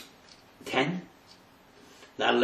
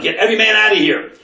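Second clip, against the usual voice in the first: higher and louder royal order, fast; commanding shout; 10 semitones higher, 9 dB louder, faster than usual. Acted. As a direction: bark the king's order to clear the room. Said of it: "Yosef made an announcement".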